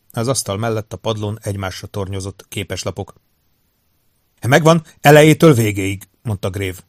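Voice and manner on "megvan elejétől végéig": loud, higher, eager announcement; pleased report. A narrator's reading. Grave proudly reports the recording.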